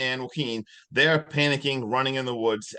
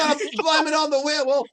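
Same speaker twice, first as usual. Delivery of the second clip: high, loud madman's excuse; hammed-up manic declaration; acted crazed ranting; 12 semitones higher, 6 dB louder than usual. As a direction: rave like an unhinged attacker. Crazed attacker's boast, acted out.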